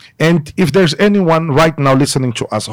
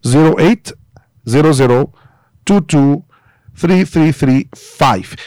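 number read slowly in separated groups, with pauses; slow, deliberate dictation with rising group endings; slow repeat. Real, not acted. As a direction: spell it out digit by digit for someone writing it down. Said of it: "I'll repeat it slowly".

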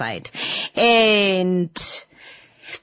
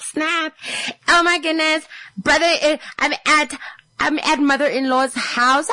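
high, excited bragging; hyped girlfriend; 6 semitones higher than usual. Retold or imitated. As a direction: show off like she's filming herself. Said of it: "She's just out here snapping".

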